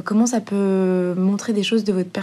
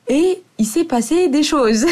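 laughing; 6 semitones higher, 4 dB louder than usual. Real, not acted. Laughing about her story.

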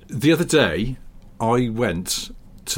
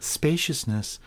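a light Southern Californian drawl on Spaciousness, softer; Southern Californian accent; 5 semitones higher, 4 dB quieter, much slower than usual. Shown as a demonstration. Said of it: "saying spaciousness in a Southern Californian accent".

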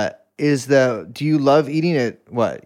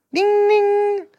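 high, sustained ringing dings; imitated bell. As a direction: ring out like a little bell. Imitating sounds of Sillydelphia.

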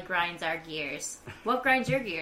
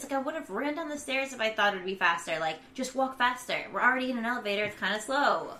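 high-pitched exasperated rant; freaking out; 5 semitones higher than usual. Retold or imitated. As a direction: plead impatiently, pitched up, as if running late. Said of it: "freak out".

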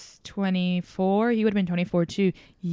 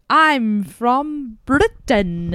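very slow, louder mock posh British accent; 4 semitones higher, 6 dB louder, much slower than usual. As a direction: drag out the words like a proud posh Brit. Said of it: "she said".

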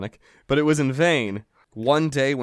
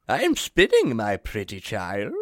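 put-on old woman voice; 4 semitones lower than usual.

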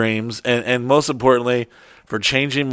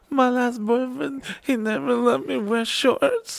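much higher, a mocking girly voice; whiny girlfriend; 11 semitones higher, 5 dB quieter, slower than usual. Girlfriend telling him she has no pants, mock whiny. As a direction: imitate her plaintive excuse. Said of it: "It's like".

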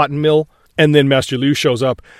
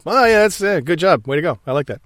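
patronising praise; 3 semitones higher than usual. Retold or imitated. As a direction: humour the kid kindly. Ip Man toying with student.

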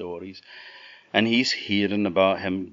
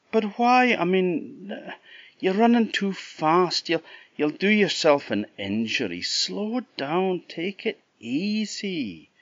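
worried Jack; 12 semitones higher, 4 dB louder than usual. Acted. Jack begging the running mule to ease off, anxious. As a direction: coax her gently, anxious.

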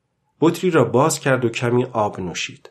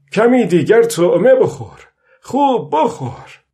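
high, louder coaxing with drawn-out ending; urging the fish; 9 semitones higher, 4 dB louder than usual. A narrator's reading. Old man talks to the fish to take the bait, coaxing. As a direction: coax insistently, pitched well up.

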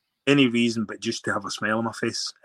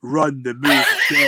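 louder, laughing through the order; laughing insistence; 3 semitones higher, 7 dB louder than usual. Retold or imitated. Telling the young scrum half to rerun the move, laughing.

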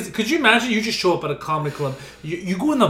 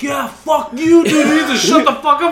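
crowd yelling; 8 semitones higher, 6 dB louder than usual. Retold or imitated. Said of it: "all the crowd's like".

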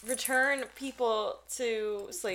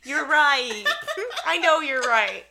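louder, laughing concession; 7 semitones higher, 9 dB louder than usual. Real, not acted. Host laughing as she concedes the point, louder. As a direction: laugh while admitting defeat.

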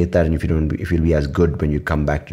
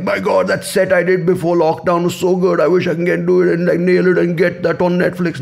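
high, loud nostalgic moan; hammed-up wistful complaint; mock whiny comedian; 11 semitones higher, 3 dB louder than usual. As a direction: imitate a comic pining for his old set. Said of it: "You don't have to go like".

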